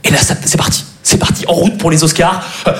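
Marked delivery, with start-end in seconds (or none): none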